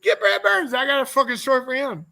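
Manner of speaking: high, loud eager call; excited call. Real, not acted. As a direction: jump on the idea eagerly.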